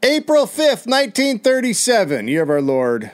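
booming historical narrator; grand storyteller. Acted. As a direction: open the tale like a pompous herald. Dramatic story opening.

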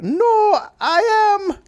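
loud, very high theatrical wail; hammed-up hysterical protest in falsetto; bewitched Elizabeth. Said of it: "what was she like".

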